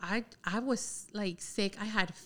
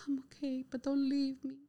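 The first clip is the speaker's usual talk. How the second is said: mock-tearful plea, higher and softer; dramatic plea; 5 semitones higher, 4 dB quieter than usual. Retelling begging her friend to stay, mock tearful. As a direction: beg her not to go, hammed up. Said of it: "So dramatic".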